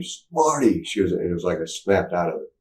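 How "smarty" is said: high retold holler; mock holler, pitched up; hollered name. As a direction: call a name out to snap someone out of it. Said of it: "she hollered my name".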